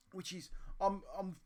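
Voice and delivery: ordinary delivery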